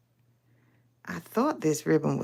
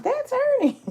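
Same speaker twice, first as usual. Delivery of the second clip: excited recognition; 6 dB louder than usual. Retold or imitated. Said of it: "many of my friends from Limar were like".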